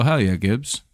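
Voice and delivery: ordinary delivery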